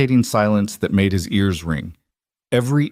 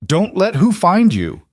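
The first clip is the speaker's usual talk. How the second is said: alarmed; 10 semitones higher, 4 dB louder than usual. Acted. Alarmed question in danger.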